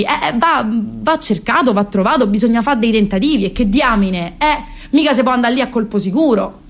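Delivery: usual speaking voice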